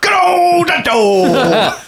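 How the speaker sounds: high-pitched, drawn-out playful shout; mock cheer. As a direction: hail an old pal, hammed-up. Mocking the slip with a cheer.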